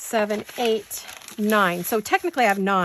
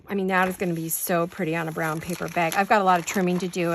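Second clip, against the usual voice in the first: usual speaking voice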